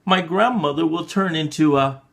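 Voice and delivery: plain reading voice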